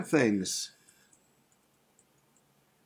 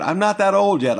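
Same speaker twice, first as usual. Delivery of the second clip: much louder, higher indignant exclamation; indignant refusal; 6 semitones higher, 9 dB louder than usual. Retold or imitated. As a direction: push back hard, you're not that old. Refusing young pastor's help.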